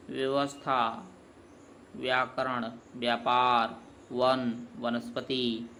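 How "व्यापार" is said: ordinary talk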